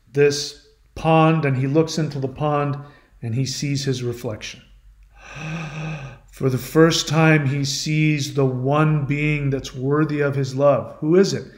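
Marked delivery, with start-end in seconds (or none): 5.4-6.1 soft gasp at a sudden sight; quiet storyteller's gasp; awed gasp. Retold or imitated. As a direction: breathe in as if struck by your reflection. Narcissus sees his reflection.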